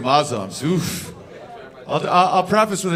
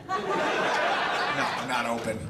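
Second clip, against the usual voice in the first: very slow, softer deadpan; 5 semitones lower, 6 dB quieter, much slower than usual. Real, not acted. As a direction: drag the words out, dry. Self-correcting deadpan.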